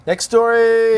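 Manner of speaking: loud, high announcement; calling out. Real, not acted. Co-host pushes on to the next story, calling out loudly. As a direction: cut in loudly to move things along.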